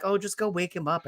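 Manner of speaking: quick, offhand mock nonchalance; nonchalant. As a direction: toss it off softly, as if it's no matter.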